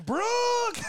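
high, loud holler of the name; high loud call; retold holler. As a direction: call the dog across the woods. Hollering for the dog.

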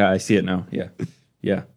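ordinary delivery

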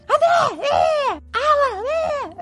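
imitating kids yelling at each other; high-pitched sibling shouts, traded back and forth; shouting. Two brothers yelling at each other, high-pitched shouting. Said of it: "Eles gritavam entre eles".

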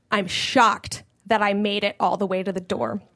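normal talking voice

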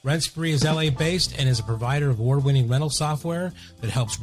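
soft, even sponsor read. Acted. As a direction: read the sponsor spot smoothly. Sponsor ad read over music.

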